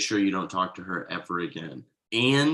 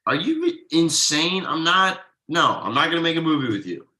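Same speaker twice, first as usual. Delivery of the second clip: louder, higher angry refusal; 6 semitones higher, 5 dB louder than usual. Acted. Thomas to his friend refusing the movie, angry and loud. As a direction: slam the idea down, outraged.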